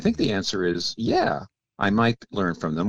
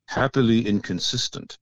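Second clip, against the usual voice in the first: lightly imitated French accent, a little higher; 4 semitones higher than usual. Speaker recalling his seminary professor's phrase, light French accent. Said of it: "he said it in his French a-, accent".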